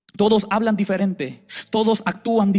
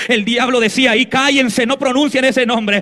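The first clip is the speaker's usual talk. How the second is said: preaching; 3 semitones higher, 4 dB louder than usual. Acted. Preaching the spiritual battle.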